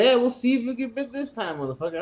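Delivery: a hint of a sneer; retold taunt.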